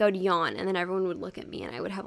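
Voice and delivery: ordinary delivery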